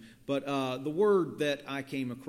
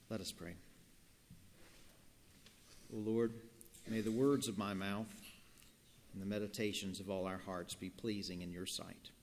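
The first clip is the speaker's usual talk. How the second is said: prayer; 3 semitones lower, 6 dB quieter than usual. Acted. Leads prayer.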